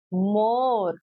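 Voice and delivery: teacher blending the word for pupils; blended word. Shown as a demonstration. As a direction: say मोर as one smooth word after the letters. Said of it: "तो इसको हम कैसे पढ़ रहे हैं?".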